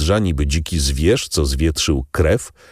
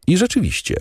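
usual speaking voice

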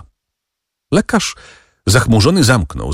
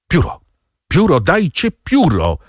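urgent call; 9 semitones higher than usual. A narrator's reading. Doctor demands a quill to save the patient, urgent high call. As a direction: call out urgently for it, without full shouting. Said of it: "zawołał lekarz".

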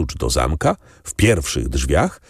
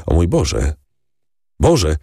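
alarmed cry. A narrator's reading. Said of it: "zawołał Morel, blednąc".

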